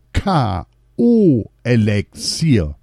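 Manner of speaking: slow, spelled-out label reading; reading aloud. A narrator's reading. King reading the flask label aloud, slow. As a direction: read out each letter slowly. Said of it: "las dieser laut vor".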